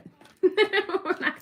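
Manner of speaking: loud high burst of laughter. Real, not acted. Vlogger to viewers, bursting into loud laughter. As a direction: crack up at yourself out loud.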